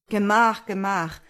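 loud, protesting farmer; gerufen. A narrator's reading. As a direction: call out to halt someone, first word loudest. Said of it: "rief der Bauer". (laut abwehrender Zuruf)

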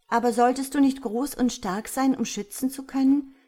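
crossly challenging; annoyed. A narrator's reading. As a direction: cut in with impatient doubt. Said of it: "unterbrach ihn das Mädchen ärgerlich".

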